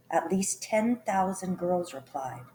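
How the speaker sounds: plain reading voice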